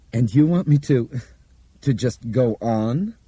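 high, halting disbelief, slowing down; incredulous question, voice pitched well up. A narrator's reading. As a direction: stumble over it in loud disbelief. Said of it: "Bob asked incredulously".

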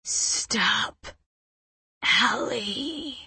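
airy whisper, like air escaping a tire; weak, hissed begging; hollow hissing plea. A narrator's reading. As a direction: plead with almost no voice. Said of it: "Her plea is hollow and hissing, like air escaping a tire".

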